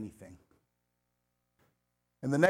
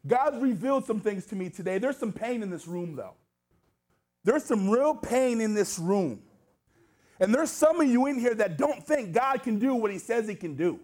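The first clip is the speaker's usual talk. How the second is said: raised-pitch, emphatic sermon delivery; impassioned preaching; 6 semitones higher, 4 dB louder, slower than usual. Real, not acted. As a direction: press each phrase home to the congregation. Preaches with force to congregation.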